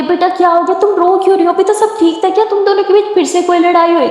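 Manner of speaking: worried aunt. Acted. Aunt asking crying Simran what happened, worried.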